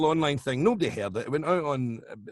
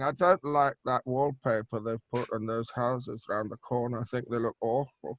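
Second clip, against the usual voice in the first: dry, lower-pitched complaining; 4 semitones lower, 5 dB quieter than usual. Retold or imitated. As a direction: softly grumble as the droll pianist. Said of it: "he'll complain about anything".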